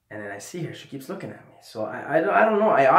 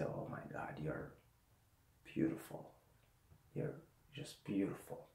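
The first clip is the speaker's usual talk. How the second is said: a soft stoned whisper of wonder; awestruck whisper; 7 semitones lower, 18 dB quieter than usual. Retold or imitated. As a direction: breathe it out, hypnotized. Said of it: "I'm mumbling".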